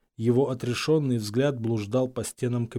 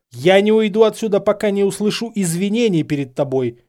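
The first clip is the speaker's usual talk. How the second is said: loud, raised, angry insistence; through gritted teeth; 8 semitones higher, 8 dB louder than usual. A narrator's reading. Harry demanding an apology, angrily through his teeth. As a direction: refuse to budge, jaw tight. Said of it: "процедил Гарри сквозь зубы".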